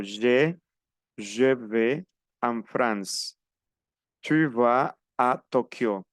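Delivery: slow, each word apart; separate words demo. Shown as a demonstration. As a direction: pronounce each word on its own, slowly. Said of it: "no se puede unir". (despacio, palabra por palabra)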